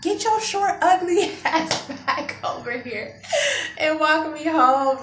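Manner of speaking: high, drawn-out angry demand; hammed-up irate order; going off. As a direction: re-enact telling him off, high and loud. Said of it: "I'm going off".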